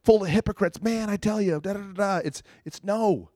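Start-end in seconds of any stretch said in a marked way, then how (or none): 0.0-2.2 high, sneering mock complaint; mocking church basher. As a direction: parody a Facebook hypocrite-basher. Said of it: "not through Facebook and bashing".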